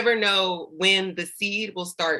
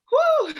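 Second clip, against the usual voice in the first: emotional whew; 4 dB louder than usual. Real, not acted. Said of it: "trying not to sweat and cry".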